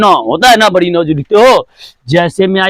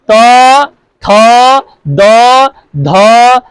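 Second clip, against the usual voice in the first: dental letters apart, a little louder; slow spaced recitation; 4 dB louder, much slower than usual. Shown as a demonstration. Teacher shows the tongue touching the teeth, slow and spaced. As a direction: tap each letter out separately. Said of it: "पूरा जी को touch करता है".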